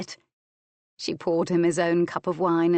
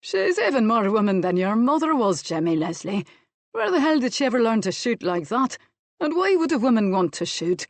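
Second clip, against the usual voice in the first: raised, astonished admiration; admiring amazement; 4 semitones higher, 4 dB louder than usual. A narrator's reading. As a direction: marvel aloud at her. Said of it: "Jock Bruce said admiringly".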